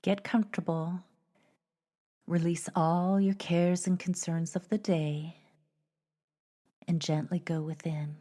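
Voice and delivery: measured meditation voice with long pauses; unhurried, even guided-journey narration. Acted. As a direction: gently lead listeners inward, leaving space between phrases.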